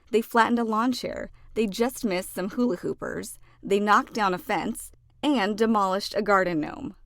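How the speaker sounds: plain reading voice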